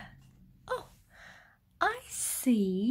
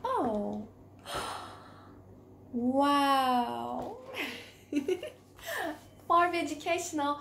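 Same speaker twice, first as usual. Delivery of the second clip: plain reading voice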